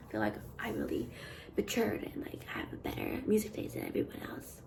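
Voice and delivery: soft conspiratorial whisper; whispered aside. Real, not acted. Vlogger confides her music taste to viewers, whispering. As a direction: whisper it like a secret brag. Confessing a smug opinion aside.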